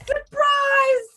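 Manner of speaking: high-pitched, sung-out surprise shout; playful, piercing exclamation; gleeful mock shout. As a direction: shout it like springing a naughty reveal. Mock reveal to shocked husband.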